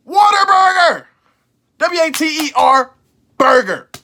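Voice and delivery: Whataburger said as "Water burger", high and spelt out W A T E R; kid pronunciation. Shown as a demonstration. Recalling how he said the name as a kid, "Water burger". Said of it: "that's how I pronounced it".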